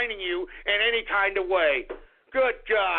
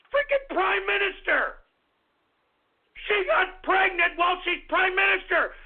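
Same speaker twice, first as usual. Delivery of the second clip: high-pitched outraged yelling; furious rant; 6 semitones higher than usual. Real, not acted. Host berating an absent prime minister, furious high yelling. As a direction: shriek it in exasperation.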